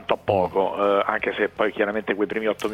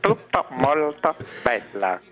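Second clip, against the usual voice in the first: Pizzella catchphrase; 3 semitones higher, much slower than usual. Retold or imitated. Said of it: "direbbe il maestro Pizzella".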